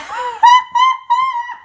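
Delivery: loud burst of mocking laughter; real scoffing laugh. Real, not acted. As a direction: laugh out loud at the idea, high and full. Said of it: "I laughed".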